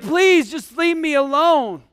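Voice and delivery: high, loud, drawn-out plea; hammed-up begging to be left in peace; exasperated pleading. Parent locked in the bathroom begging the kids, exasperated.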